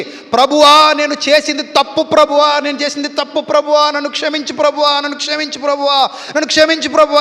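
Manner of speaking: high, loud crying prayer for mercy; weeping plea. Acted. King weeping before God, begging for forgiveness in a high loud voice. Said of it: "అని ఏడుస్తూనే ఉన్నాడు".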